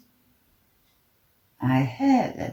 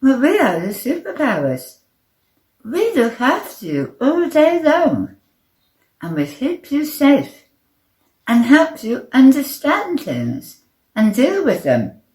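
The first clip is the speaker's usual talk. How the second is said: higher-pitched little character voice; Nervous, explaining earnestly; 7 semitones higher, 6 dB louder than usual. A narrator's reading. Little superpower explaining his job, earnest.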